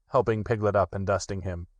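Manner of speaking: usual speaking voice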